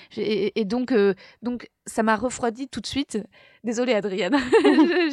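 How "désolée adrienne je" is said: laughing. Real, not acted. Laughing apology to her friend.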